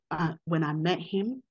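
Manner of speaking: ordinary talk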